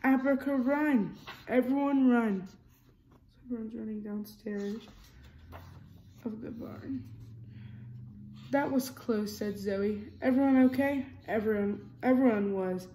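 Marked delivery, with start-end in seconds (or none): 0.0-2.5 lively storybook reading, character lines a bit louder; reading to children. A narrator's reading. Reading picture book aloud. 8.5-12.9 lively storybook reading, character lines a bit louder; reading to children. A narrator's reading. Reading picture book aloud.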